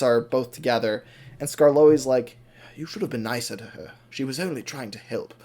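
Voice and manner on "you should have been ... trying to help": hushed, soft acted rebuke; gentle reproach. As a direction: chide a friend quietly at night. Skarloey gently reproaches Rheneas at night.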